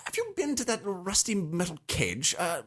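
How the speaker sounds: high, affected gossip; put-on high society voice; hammed-up snob. Continues the snob bit.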